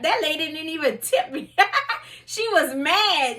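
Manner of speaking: amused, with a laugh breaking in. Real, not acted. Driver recounting the lost tip from an upset customer, laughing.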